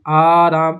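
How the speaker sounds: آرام with the first long aa drawn out slowly; آرام with a long, lengthened aa; stretched alif. Shown as a demonstration. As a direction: hold the aa in آرام. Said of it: "تھوڑا سا کھینچ کر پڑھیں گے کہ آرام".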